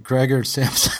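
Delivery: "Gregor Samsa" said the English way; English pronunciation. Shown as a demonstration. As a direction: anglicise the name "Gregor Samsa". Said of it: "just do it the English way".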